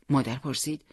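plain reading voice

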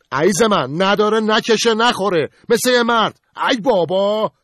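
high, loud, slow exasperated complaint; exasperated husband. Acted. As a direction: throw it at the wife, fed up. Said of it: "قربان به طرف زنش چرخید و گفت". (با صدای زیر و بلند و کلافه به زنش)

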